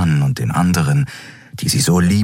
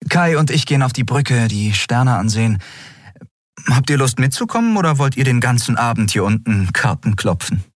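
higher, lightly voiced captain, casual and friendly; cheerful invitation; 6 semitones higher than usual. A narrator's reading. Endriel inviting the crew to the bridge, lightly voiced. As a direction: call the crew up with a teasing lilt.

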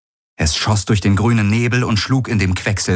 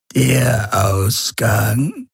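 very slow, drawn-out eerie voice, higher pitch; Urus Khan; 4 semitones higher, much slower than usual. A narrator's reading. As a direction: stretch each word like a dying alien. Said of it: "kam die Stimme des Urus Khan über das Comm". (langsame, gedehnte Geisterstimme)

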